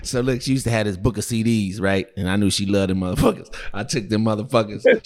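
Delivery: ordinary talk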